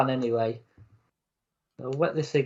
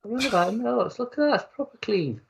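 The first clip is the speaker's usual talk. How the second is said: high, jokey protest; mock indignation; 8 semitones higher than usual. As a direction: play offended for laughs. Mock-indignant about the dirty water.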